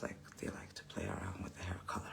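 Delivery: fast secretive whisper, no voice at all. Real, not acted. Confiding salon gossip to viewers in a fast whisper. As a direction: let it slip like gossip under your breath.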